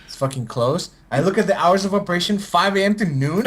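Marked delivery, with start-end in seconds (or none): none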